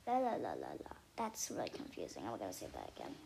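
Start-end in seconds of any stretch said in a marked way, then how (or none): none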